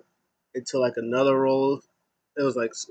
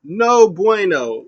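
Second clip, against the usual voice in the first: high, loud mock rant; hammed-up angry tirade listing his titles; Kalisto yelling; 9 semitones higher, 5 dB louder than usual. Kalisto yelling at Lindsay Dorado after losing, high loud mock rant. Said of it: "he's yelling at Lindsay Dema".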